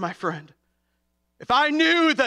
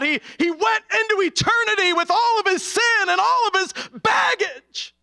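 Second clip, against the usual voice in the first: preaching at full force; 8 semitones higher than usual. Acted. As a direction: urge them on, rising to a cry.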